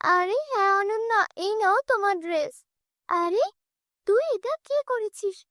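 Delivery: high, shrill-voiced tattling girl; whiny stepsister. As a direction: wail for mom to come see. Stepsister accuses, calls mother.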